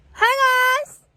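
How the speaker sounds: high, bright vlog greeting; excited greeting. Real, not acted.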